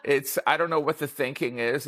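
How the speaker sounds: ordinary talk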